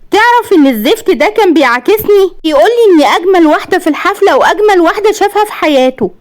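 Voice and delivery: very high, agitated protest; high-pitched indignant complaint; outraged wife. A narrator's reading. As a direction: pitch way up as you report the insult. Wife protests harassment to husband.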